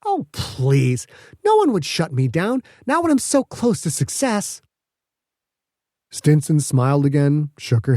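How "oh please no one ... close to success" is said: scoffing, rising higher; mildly higher huffy scoff; huffy dismissal. Acted. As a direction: wave it away with disdain. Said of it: "Petra let out a huff".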